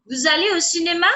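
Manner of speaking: "Vous allez au cinéma" said quickly, rising high at the end; questioning intonation. Shown as a demonstration. French teacher showing how a question is asked, questioning intonation. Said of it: "if it's a question, I would say".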